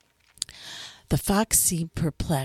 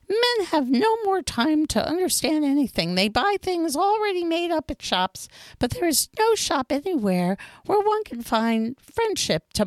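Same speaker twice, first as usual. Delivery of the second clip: higher fox voice, earnest and pleading; fox character voice; 12 semitones higher than usual. A narrator's reading. Fox explains to the little prince what taming means, higher and earnest.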